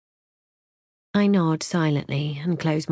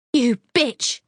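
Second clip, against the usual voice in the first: high furious shout at her back; jilted woman screaming an insult; 8 semitones higher, 4 dB louder than usual. A narrator's reading. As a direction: scream it after her as she walks off. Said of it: "Liz shouts to my retreating back".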